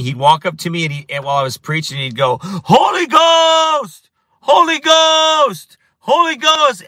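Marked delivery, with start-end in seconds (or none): 2.4-6.8 drawn-out, very high-pitched shout; mock yelling. Kid heckling a street preacher, yelling. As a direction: imitate a heckler hollering, stretching the words out high and loud. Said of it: "he kept yelling at me".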